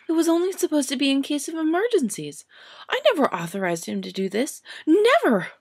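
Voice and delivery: high and loud, building to a cry of Never; indignant young witch. Acted. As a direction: protest until you burst. Hermione protests, building.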